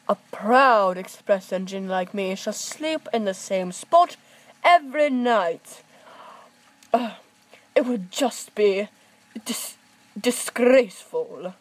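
loud, pompous boasting engine; proud express engine. Acted. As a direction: boom it out with pride.

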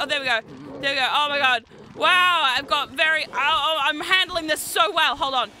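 usual speaking voice

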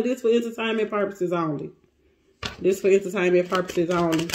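low, quick repeat. Real, not acted. Reader repeating the disclaimer to viewers, low aside. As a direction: drop the pitch and rattle it off.

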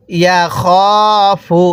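"ইয়া খ ফু" with a long held খ; drawn-out vowel. Shown as a demonstration. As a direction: stretch the খ in "ইয়া খ ফু". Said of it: "এরকমভাবে শিখব".

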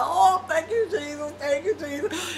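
imitating a teen overcome in church prayer; fervent praise. Teen at a church prayer call, high fervent thanks. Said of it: "and I'd be like".

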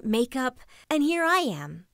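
normal talking voice

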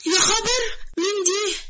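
put-on high, shocked old mother; mother sees her shrunken daughter, high shocked cry; shocked gasp. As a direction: recoil in disbelief, high.

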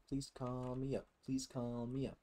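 ordinary talk

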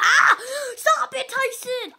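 high-pitched outburst at a friend; yelled protest. Real, not acted. Player interrupted by a friend mid-list, high yelled protest. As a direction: break off with a high squeal of protest. Interrupted by Tyson while reciting.

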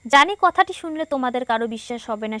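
জানি কথাটি শুনলে run straight on with no pause after জানি; জানি কথাটি running into শুনলে unbroken; read without pauses. Shown as a demonstration. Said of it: "অনুচ্ছেদটি পড়তে গিয়ে আমি, আহ, প্রায় হাঁপিয়ে গিয়েছিলাম".

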